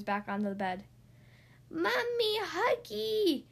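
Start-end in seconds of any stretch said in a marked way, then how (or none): 1.7-3.4 put-on very high toddler wail; screaming baby. A narrator's reading. Baby demands Mommy's hug, screaming. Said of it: "screamed the baby".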